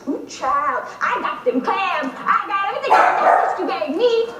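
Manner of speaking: hammed-up sass, growing in force; bigger caricature read.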